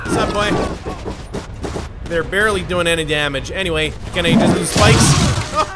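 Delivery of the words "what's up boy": whispered taunt. Acted. Slow breathy taunt.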